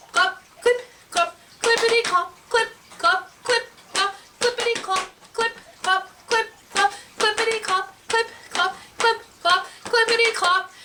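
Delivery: high rhythmic clip-clop; hoofbeat imitation. A narrator's reading. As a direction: tap out trotting hooves with the voice.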